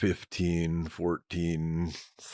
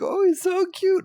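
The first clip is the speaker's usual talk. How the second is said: squeaky high cooing; girly high voice; 5 dB louder than usual. Retold or imitated. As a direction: coo it like a girl over a puppy. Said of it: "be like".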